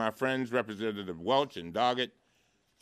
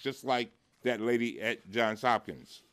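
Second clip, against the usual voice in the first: ordinary talk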